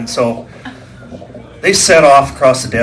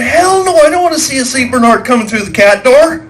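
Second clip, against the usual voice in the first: high, loud horrified no; husband turning down the doctor's offer; emphatic refusal; 11 semitones higher, 4 dB louder than usual. Retold or imitated. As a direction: refuse it loudly. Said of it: "And I went".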